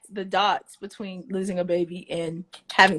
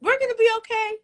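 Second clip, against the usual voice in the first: much higher, bright and encouraging; upbeat reassurance; 11 semitones higher, 4 dB louder, faster than usual. Retold or imitated. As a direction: promise a friend it will all work out. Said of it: "I always say".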